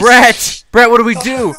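high, loud cry for help; panicked yell. Acted. Robber asking his partner for help, panicked yell.

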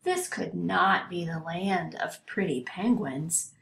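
ordinary delivery

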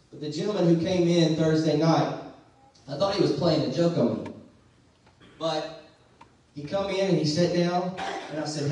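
usual speaking voice

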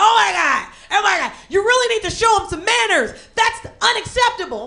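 high-pitched mock outrage. Man scolds the cousin's guardian over stepped-on shoes, mock irate. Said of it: "the guy got so irate".

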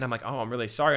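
ordinary talk

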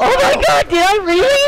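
excited shriek. Real, not acted. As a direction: let it fly in pure elation. Stunned gaming victory moment.

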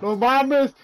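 loud shout of disbelief; excited yell. Real, not acted. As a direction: blurt it out amazed. Car crash in game.

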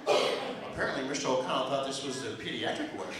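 low, soft snide aside; mocking nurse. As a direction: murmur it like gossip out in the hall. Nurses mocking him outside.

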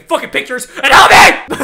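loud plea bursting into a yell; exasperated yelling. Real, not acted. Player scolding friends taking pictures, exasperated yell. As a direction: yell at friends who won't help.

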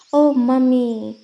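slow, loud mock wail. A narrator's reading. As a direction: draw out the cry like a scared child. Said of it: "wailed Suri".

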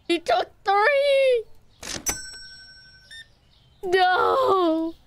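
slow, high, crying shout of despair; anguished wail. Real, not acted. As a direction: wail it out like the loss is unbearable. Robbed in game, wailing.

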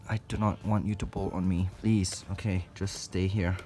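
ordinary talk

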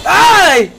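loud, high yelp; mock startled yelp; startled cry. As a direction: yelp in surprise. Sudden cry in game.

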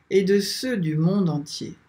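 ordinary talk, nothing marked

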